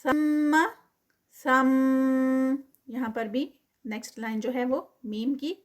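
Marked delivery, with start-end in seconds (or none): 0.0-2.6 ثم with a held nasal hum on the میم; tajweed recitation. Shown as a demonstration. Teacher models ثم with ghunna for students. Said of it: "line میم کے ساتھ".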